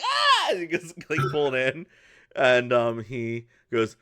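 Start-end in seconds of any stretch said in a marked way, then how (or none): 0.0-0.5 put-on high, loud child's squeal; short yelp as if yanked away; kid's yelp. Kid gets pulled into the carriage, high yelp. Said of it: "Kid's like".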